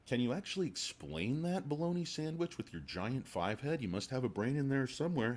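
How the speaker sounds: high, mocking taunt. Taunting the analyst.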